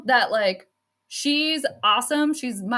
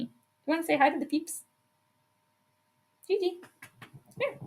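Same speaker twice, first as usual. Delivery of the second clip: talking to the dog; 4 semitones higher, 7 dB quieter than usual. Real, not acted. Coaxing the dog to greet viewers.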